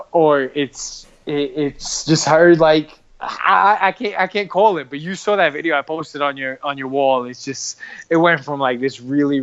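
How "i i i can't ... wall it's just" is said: ordinary talk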